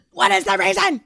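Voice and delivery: high-pitched, squealed bafflement; strained high cry of frustration; exasperated outburst. Real, not acted. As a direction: burst out in exasperated disbelief.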